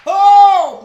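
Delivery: sudden loud high Oh; exclaiming loud; loud cry. Real, not acted. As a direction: burst out with a loud Oh. Sudden outburst mid sermon.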